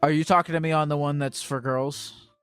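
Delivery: ordinary talk, nothing marked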